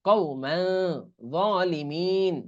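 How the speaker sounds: কওমান with nasal মাং, যালিমিন drawn out; slow recitation. Shown as a demonstration. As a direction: stretch যালিমিন slowly. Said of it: "মা উচ্চারণ হয়". (ধীর তিলাওয়াত)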